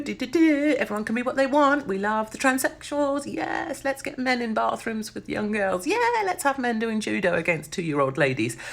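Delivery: mock the left's slogans, eye-rolling; sarcastic mockery.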